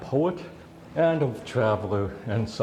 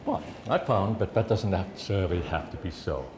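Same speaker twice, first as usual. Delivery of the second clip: ordinary delivery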